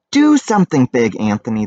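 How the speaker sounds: shouted plea. Acted. Townspeople shout to Big Anthony for help, loud. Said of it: "they shouted".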